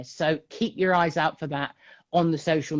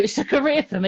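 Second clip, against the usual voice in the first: ordinary talk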